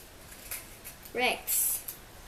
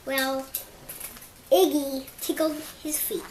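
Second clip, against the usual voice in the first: ordinary delivery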